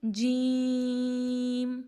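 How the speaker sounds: very slow جیم held long; elongated letter jim. Shown as a demonstration. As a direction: draw out جیم for three counts. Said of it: "ادا کریں گے؟".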